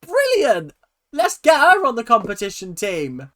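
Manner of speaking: high, loud, gleeful exclaiming; excited cheering. Real, not acted. Reviewer thrilled the new mom plays along, gleeful shouting. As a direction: hype it up, stretching key words. Thrilled reaction to mom's flattery.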